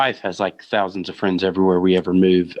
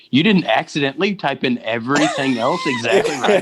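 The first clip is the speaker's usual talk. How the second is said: higher, laughing as it rises; laughing mock question; 8 semitones higher than usual. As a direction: tease the searcher while giggling. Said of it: "It's like".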